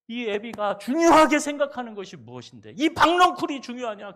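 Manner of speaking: loud, high rebuke from God; God rebuking. Acted. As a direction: thunder at Jonah like an angry father. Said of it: "하나님이 그러셨어요". (요나를 꾸짖는 하나님, 큰 소리로 호통)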